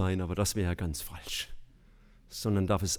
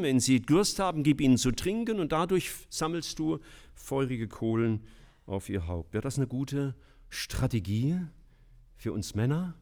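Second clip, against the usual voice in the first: ordinary talk, nothing marked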